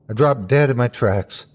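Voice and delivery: plain reading voice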